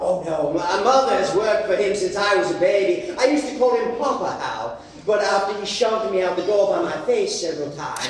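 normal talking voice

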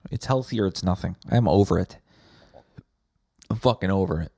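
ordinary delivery

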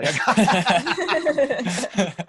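slow, high laughing through words. Real, not acted. Telling his friends about running away, laughing. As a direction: laugh it out helplessly.

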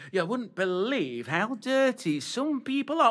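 high, hammed-up dame to the boys and girls; panto dame. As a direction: camp it up for the audience. Back in panto dame role.

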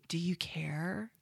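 deadpan. Retold or imitated. Mock flat follow-up.

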